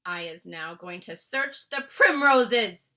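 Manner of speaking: loud slow giant voice. Acted. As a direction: threaten slowly. Bloodbottler giant voice.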